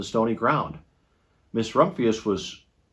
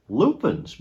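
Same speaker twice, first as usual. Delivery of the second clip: satisfied; 7 semitones higher than usual. A narrator's reading. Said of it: "Miss Rumphius said with satisfaction".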